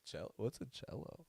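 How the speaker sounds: quiet dopey mumble. Retold or imitated. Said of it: "That one's just like".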